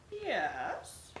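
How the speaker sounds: soft whispered yes. Acted. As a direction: breathe the answer. Dragon answers quietly.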